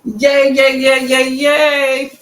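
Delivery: loud happy yays; excited cheering. Real, not acted. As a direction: cheer like you just won. Cheering at a chosen answer.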